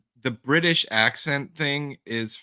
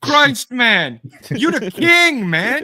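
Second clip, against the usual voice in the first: much higher and louder hammed-up praise for Christ; mock cheering; 12 semitones higher, 8 dB louder, much slower than usual. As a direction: shout it like a giddy fan, laughing. Loud mock praise in the bit.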